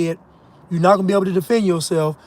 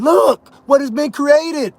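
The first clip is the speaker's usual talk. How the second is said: exclaiming; 5 semitones higher, slower than usual. Real, not acted. Climax of rant, exclaiming.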